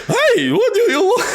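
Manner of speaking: very high, loud squeak, breaking into laughs; Mad Hatter voice. Acted. Laughing through his Mad Hatter attempt, very high and loud. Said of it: "Mad Hatter voice".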